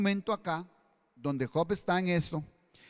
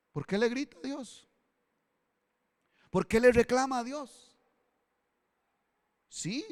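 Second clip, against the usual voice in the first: ordinary delivery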